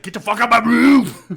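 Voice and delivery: yelled, high and loud; angry shout. Retold or imitated. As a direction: bellow it at the intruder. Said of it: "I'd be like".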